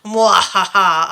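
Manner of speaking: slow, low villain's mwahaha; evil laugh. Acted. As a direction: cackle like a gloating villain. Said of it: "that did take a slightly evil turn".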